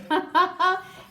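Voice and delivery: high, loud burst of laughter; lion's merry laugh. Acted. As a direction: laugh out merrily. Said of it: "he laughed merrily".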